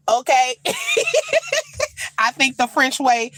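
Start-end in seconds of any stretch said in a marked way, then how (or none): 0.6-2.1 squealing laugh up high; high burst of laughter; high shrieking laugh. Real, not acted. Laughing at the translation.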